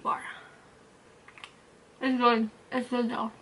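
talking while eating; biting snack mid-sentence; mouth full chewing. Real, not acted. Seen 4: Bites fudge bar at mouth early; hands move to bag by 1.7.

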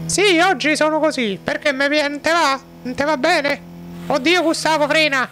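shrill-free high squeaky woman, alarmed; Gustava voice. Acted. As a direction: panicky high falsetto wife. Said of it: "Ogni tanto cambia voce la Gustava".

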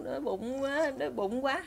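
soft, small whimper of hunger; mild crying complaint. Retold or imitated. Hungry little brother being led away, soft mock whimpering. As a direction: lightly imitate a hungry child fretting. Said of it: "thằng nhỏ nó cứ khóc".

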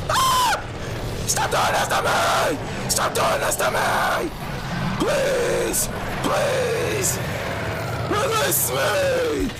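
shrill desperate pleading screams; panicked screaming. Real, not acted. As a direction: shriek in terror, begging. Gamer panicking in trap.

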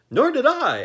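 very high, bright, cheery Jack voice; pleasant Pumpkinhead. A narrator's reading. Jack cheerfully reassuring the ferryman, very high and pleasant. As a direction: sing it out, untroubled and friendly. Jack's voice.

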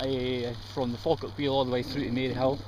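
normal talking voice

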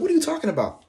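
high-pitched mock disbelief; squeaky retold confusion; bewildered protest.

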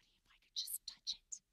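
breathless whisper to herself; whispered thought. Acted. Preacher acting the woman's secret thought in a whisper. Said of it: "And she thought".

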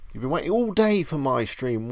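normal talking voice

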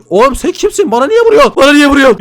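real indignant yelling. Real, not acted. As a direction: yell at the thief who keeps hitting you. Attacked by player stealing items.

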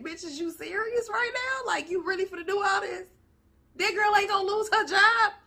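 high, mock-incredulous manager voice; manager clapping back. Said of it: "he was really like".

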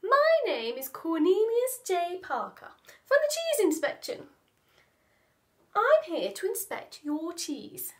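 a lightly voiced pompous inspector; pompous mouse. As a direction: puff up like a fussy official.

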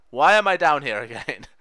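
normal talking voice

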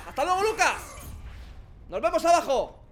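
normal talking voice

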